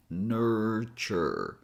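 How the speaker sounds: "Nurture" drawn out as nuurr-chuurr; slow syllable demo. Shown as a demonstration. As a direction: stretch both syllables of "Nurture" for learners. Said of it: "we only have nur and chur".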